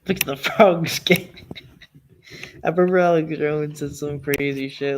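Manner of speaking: laughing. Real, not acted. Cracking up over frogs quote.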